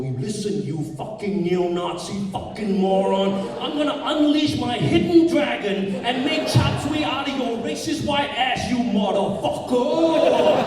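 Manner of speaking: mock-heroic angry tirade, rising in pitch; righteous anger. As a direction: build to a high, drawn-out final curse. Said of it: "react with righteous anger here".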